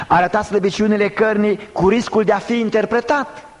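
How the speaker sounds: high, urgent preacher's declamation; forceful preaching. Real, not acted. Preacher citing Matei to the congregation, forceful preaching. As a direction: preach it on a sustained high pitch.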